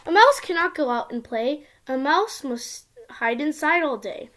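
usual speaking voice

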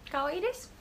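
high, sweet "kawaii des" rising at the end; cutesy anime voice. Pretend. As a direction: squeak it out like an anime girl. Cute Japanese phrase performed.

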